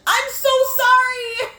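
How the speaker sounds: high, slow, loud yelp of sorry; panicked squeal of sorry; startled apology. Real, not acted. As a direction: blurt it out in a fright. Sudden game scare apology.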